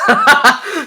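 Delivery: high, loud laugh; burst of laughter. Real, not acted. Player cracking up at Cedric's yes yes, loud high laugh.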